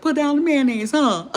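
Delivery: high, loud teasing repeat; loud mock echo. Teasing the man by repeating his words loudly. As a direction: throw his line back at him, loud. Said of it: "I said".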